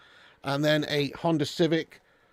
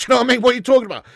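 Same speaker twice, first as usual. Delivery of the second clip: laughing, louder and higher in disbelief; 8 semitones higher, 9 dB louder, much faster than usual. Real, not acted. Streamer laughing off a viewer's posh beer claim, loud amused disbelief. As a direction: scoff through a laugh at the viewer.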